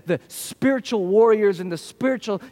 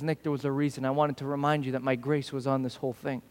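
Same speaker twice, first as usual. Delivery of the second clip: gentle voice of God; 6 semitones lower, 7 dB quieter than usual. Retold or imitated. God speaking to him.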